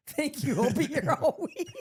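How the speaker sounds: laughing mock stage performer; hammed-up showman with a top hat; showman bow. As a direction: take a bow like a vaudeville act. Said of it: "pretended I had a top hat on".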